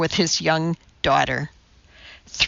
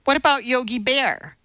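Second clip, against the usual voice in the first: put-on higher, hopeful little kid's question; child's voice; 6 semitones higher than usual. A narrator's reading. Kindergartner offers teacher a guess, child's voice. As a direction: pipe up like a kindergartner.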